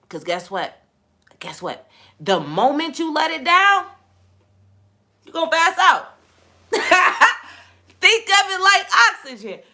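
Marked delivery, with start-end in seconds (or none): 2.2-9.6 higher, louder preaching burst with a laugh. Acted. Preacher warning listeners they will pass out, higher and louder. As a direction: drive the point home to the crowd.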